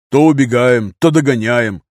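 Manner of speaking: forceful complaint; loud grumble. A narrator's reading. Fighter explaining his complaint under fire, loud grumble. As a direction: grumble loudly over gunfire.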